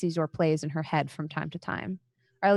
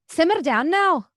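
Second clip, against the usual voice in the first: other voice; 10 semitones higher, 6 dB louder than usual. A narrator's reading. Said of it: "the other voice says".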